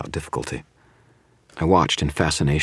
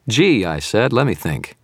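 sarcastic; 6 semitones higher, 5 dB louder than usual. A narrator's reading. Wounded hero mocks the gloating villain.

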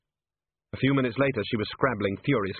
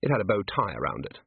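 plain reading voice

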